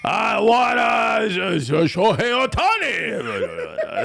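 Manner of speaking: slow hammed-up Japanese accent, half laughing; mock Japanese accent. Switching to a Japanese accent for his cohost, laughing. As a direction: stretch it out in a bad Japanese accent.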